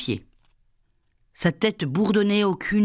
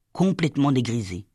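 ordinary delivery

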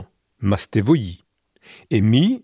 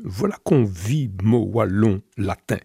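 ordinary delivery

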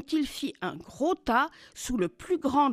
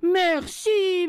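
high, loud mock thanks; cried out; 8 semitones higher, 6 dB louder than usual. A narrator's reading. Jackal spotting the crocodile, loud high cry. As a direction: call it out triumphantly. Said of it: "s'écria".

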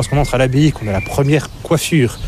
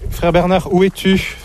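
higher calling voice across the grounds; 6 semitones higher, slower than usual. Real, not acted. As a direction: call out to him from a distance. (appel à voix haute, plus aigu)

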